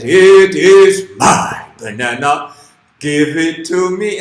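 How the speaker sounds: loud, slow bear voice; big bear. Acted. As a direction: boom it out slowly like a bear. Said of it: "the bear says".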